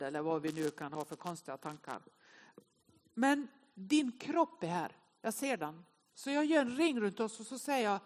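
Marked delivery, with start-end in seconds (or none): none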